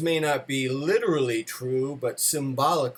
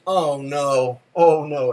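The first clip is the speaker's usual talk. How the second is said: loud, eye-rolling groan of oh no; exasperated wife; 8 dB louder, much slower than usual. Retold or imitated. Wife rolling her eyes at his dream, loud exasperated groan. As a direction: bark it out like she's sick of hearing it. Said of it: "she rolls her eyes".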